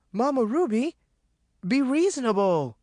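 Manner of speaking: high, anxious plea; pleading. A narrator's reading. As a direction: plead with her to calm down. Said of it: "Maureen pleaded".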